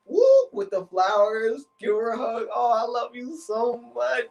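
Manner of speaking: high, drawn-out fake-loving greeting; hammed-up loving boyfriend act; played-up sweetness. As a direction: gush slowly in a high, sugary voice. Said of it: "Play it all up".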